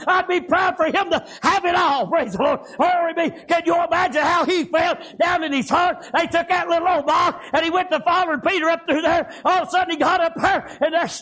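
high, driving revival preaching; fervent preaching. Real, not acted. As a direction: preach it out to the congregation, full fire. Preacher at full force, story.

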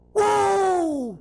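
high, long whoop falling away; imitated full-throated whoa; reared-back holler. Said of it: "I believe he rears back again".

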